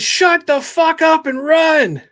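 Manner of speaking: high, loud, drawn-out panicked order; urgent shout. Acted. Tommy warning the other kids, urgent shout. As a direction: shout it high and stretched, desperate to get them moving.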